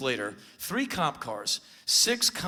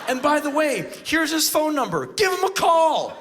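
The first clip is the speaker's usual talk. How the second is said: high, loud, emphatic; retold excited instruction; 12 semitones higher, 7 dB louder than usual. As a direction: deliver the tip triumphantly loud. Acted gleeful loud tip to police.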